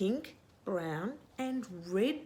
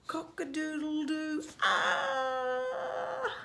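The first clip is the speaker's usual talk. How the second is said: startled rooster; much slower than usual. Acted. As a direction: crow breaking into a high scream. Rooster crows then screams.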